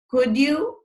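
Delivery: "could you" climbing high on "you"; rising question tag. Shown as a demonstration. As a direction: ask the favor with "could you" turning upward. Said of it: "My voice goes up".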